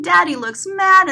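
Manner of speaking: plain reading voice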